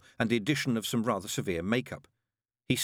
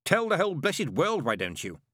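snapping; 8 semitones higher, 4 dB louder than usual. Acted. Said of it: "Crowley snapped".

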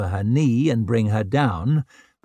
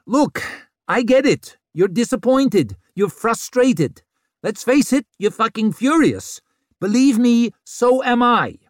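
loud; 11 semitones higher, 4 dB louder than usual. A narrator's reading. Vic addresses furious crowd.

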